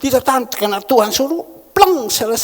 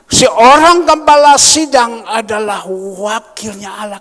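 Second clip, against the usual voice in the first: loud scripture proclamation; 3 semitones higher, 10 dB louder than usual. Real, not acted.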